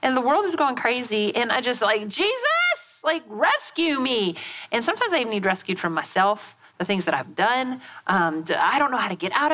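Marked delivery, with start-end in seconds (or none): 2.1-4.3 high, drawn-out cry for rescue; acted exasperated prayer, pitched up; mock desperate plea. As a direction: plead to heaven in comic exasperation. Acts out pleading prayer for rescue.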